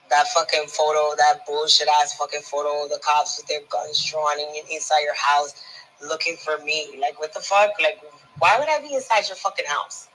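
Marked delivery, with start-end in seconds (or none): none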